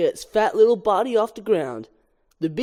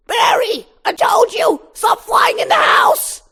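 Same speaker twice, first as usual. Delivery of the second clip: high, loud mom shout, slower at the end; furious shrill mother; 7 dB louder, slower than usual. Acted. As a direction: yell at him to stop flying indoors. Mother yells at Barry.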